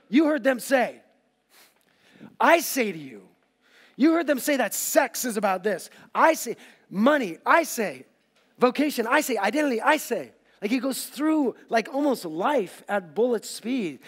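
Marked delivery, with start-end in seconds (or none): 0.1-10.3 loud, higher, declaring each point; Jesus proclaiming. Acted. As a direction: lay down the gauntlet, loud and high.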